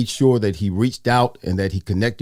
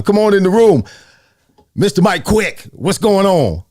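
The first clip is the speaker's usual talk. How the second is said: high, loud show introduction; calling out a welcome; 8 semitones higher, 4 dB louder than usual. Real, not acted. Host calls his friend in as surprise guest, calling out a welcome. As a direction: bring the guest on with a big, high call.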